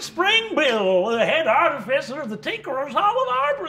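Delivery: high, loud gnome announcing himself; Crumpkin. Acted. Gnome introduced on a call, loud high self-introduction.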